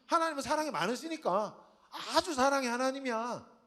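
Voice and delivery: sarcastic Jonah scoffing at God's love. Acted. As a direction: sneer out the praise of God's love. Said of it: "비꼬는 거예요".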